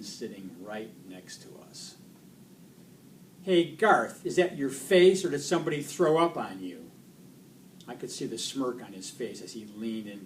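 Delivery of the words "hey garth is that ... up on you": loud, higher-pitched jeer; bully's taunt. Acted. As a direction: call out a mocking insult.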